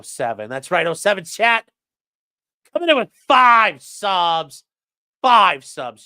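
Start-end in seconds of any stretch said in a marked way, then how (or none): 2.7-6.1 big, loud hype call; WWE announcer. Pretend. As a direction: announce it like a wrestling match, stretching the key words. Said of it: "your W W E announcer voice".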